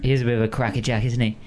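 gruff Cockney bird, teasing; Cockney accent. Acted. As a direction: crow speaking in a Cockney accent. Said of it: "my Cockney accent".

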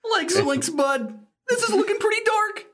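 lightly voiced Shaggy, quick and high; a hint of Shaggy's cartoon panic. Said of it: "Shaggy Rogers".

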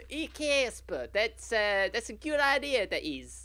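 high, badly hammed-up prankster ghost; Stretch, the ghost, praising Casper in a bad put-on voice; terrible Stretch voice. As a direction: overdo a cheeky ghost and miss. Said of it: "So terrible".

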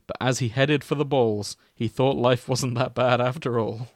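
usual speaking voice

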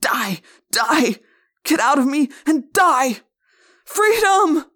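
building from shout to a very high, loud cry; freed man shrieking at the slug in his head; frenzied scream. Acted. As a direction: tear the parasite out with your voice. Freed host screaming at his Yeerk.

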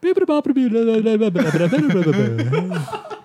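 high, rapid mock-Italian babbling; imitated cartoon plumber gibberish; Italian gibberish babble. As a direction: babble nonsense syllables like fake Italian. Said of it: "he speaks, like, Italian gibberish".

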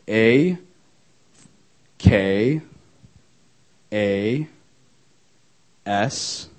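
one letter at a time, long pauses; slow spelling out. Real, not acted. Speaker spelling email for audience once they are ready, slow dictation. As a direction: spell it out deliberately, each letter rising.

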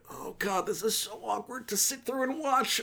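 high, soft, squirming voice of someone stuck at the table; squirming discomfort. Retold or imitated. Viewer stuck watching a family fight, squirming high and soft. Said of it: "it's just like".

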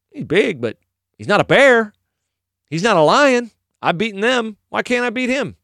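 high, confident reasoning aloud; David. Retold or imitated. David sizing up the giant, confident. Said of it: "He looked at Goliath and thought".

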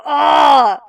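a sudden loud, high cry of shock; real shocked shout. Real, not acted. Podcaster reacting to the heroine's cruel line, shocked shout. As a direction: gasp it out as the blow lands.

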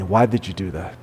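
low, soft, curious "why did you do that?"; caring dad softly asking "why did you do that?"; gentle question. Shown as a demonstration. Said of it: "we need to say, "Hey, son".

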